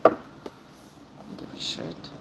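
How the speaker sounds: whispered, slow and unvoiced; slow whispered don't-care aside; muttered aside. Real, not acted. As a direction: breathe it out dismissively. Dismissive aside under breath.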